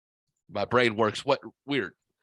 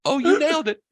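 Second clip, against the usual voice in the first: loud, high mimicry of a delighted coworker; 14 semitones higher, 6 dB louder, faster than usual. Coworker approving the school shooter nickname, imitated loud and high. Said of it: "he sounds like [name]".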